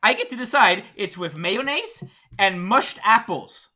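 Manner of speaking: high-pitched, emphatic lecturing; insistent rant. Real, not acted. Dutchman claiming the right to rule on fries, insistent rant. Keeps ranting about fries.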